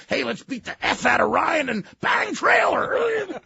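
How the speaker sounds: high slow boast; rowdy shout. Retold or imitated. Farley egging on the crew, loud and high.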